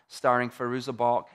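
ordinary delivery

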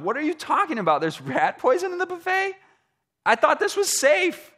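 high, alarmed disbelief; outraged, high-pitched surprise; shocked disbelief. Retold or imitated. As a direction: squeak it out in shock. Shocked diner at buffet.

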